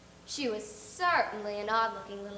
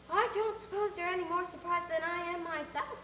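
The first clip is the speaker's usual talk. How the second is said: lightly raised dry voice; a hint of a wry spinster; Marilla, wry; 7 semitones higher than usual. Marilla answering the nosy neighbour, a little wry.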